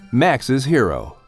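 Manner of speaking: ordinary delivery, nothing marked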